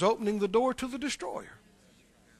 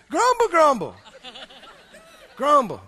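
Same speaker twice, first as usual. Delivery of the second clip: mock grumbling; 3 semitones higher, 5 dB louder than usual. Grumbling imitation.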